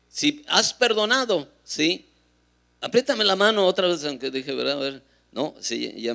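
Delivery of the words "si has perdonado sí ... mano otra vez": loud, high, insistent retold urging; forceful, raised-voice request; urgent instruction. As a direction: call out to someone barely conscious. Urging the dying man to respond.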